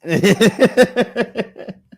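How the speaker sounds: long high, loud laugh; high cackling laughter. Real, not acted. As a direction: burst out laughing at your own bit. Laughing at his own bit.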